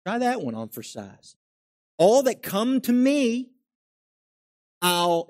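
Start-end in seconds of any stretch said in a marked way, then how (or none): 2.0-5.3 high, loud and slow, leaning on every word; emphatic preaching. Acted. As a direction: proclaim it like a promise. Preacher quoting Jesus emphatically.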